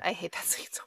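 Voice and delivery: breathy whisper of disgust. Real, not acted. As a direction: whisper it, hushed and unvoiced. Disgusted at the usher scene.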